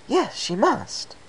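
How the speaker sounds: high, slow, eager agreement; a bit too much enthusiasm; overly enthusiastic. Acted. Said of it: "with maybe a bit too much enthusiasm".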